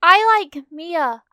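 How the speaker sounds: high, slow shy voice; mumbled. Acted. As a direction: say it shyly, pitched high. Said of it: "Koda mumbled".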